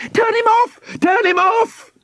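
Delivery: very high-pitched panicked cry; frantic girl's yell. A narrator's reading. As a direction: yell it urgently as a little girl. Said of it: "yelled Nina".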